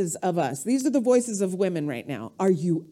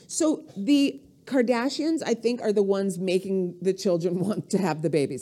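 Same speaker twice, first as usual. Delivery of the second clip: normal talking voice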